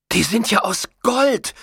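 high, amazed outburst; wide-eyed, high-pitched amazement; astonished exclamation. A narrator's reading. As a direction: exclaim at a sudden treasure. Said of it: "rief Zolfo aus".

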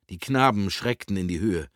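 ordinary delivery, nothing marked

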